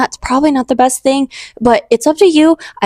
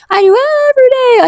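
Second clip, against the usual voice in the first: much higher, impassioned; fervent repeat; 13 semitones higher, 3 dB louder than usual. Shown as a demonstration. Testifying to viewers, much higher and fervent. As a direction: lift it high with fervor.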